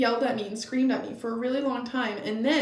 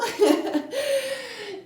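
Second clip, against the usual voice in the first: high-pitched burst of laughter; a giggle rising high and falling; 13 semitones higher than usual. Real, not acted. Laughs at her own story.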